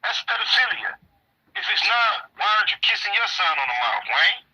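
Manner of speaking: high, pressing rhetorical questions; indignant questions aimed at Wayne; heated challenge. Real, not acted. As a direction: push him hard with every question.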